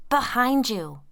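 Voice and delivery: high loud warning. A narrator's reading. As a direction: shout the warning.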